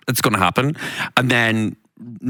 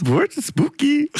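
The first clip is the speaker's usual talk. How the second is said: spooky voice; 14 semitones higher, slower than usual. Pretend. Mock spooky reaction.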